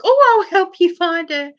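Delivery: high, loud offer of help; eager helpful stranger. Retold or imitated. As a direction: jump in urgently as a concerned passer-by.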